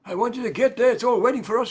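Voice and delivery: ordinary delivery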